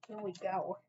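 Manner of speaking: normal talking voice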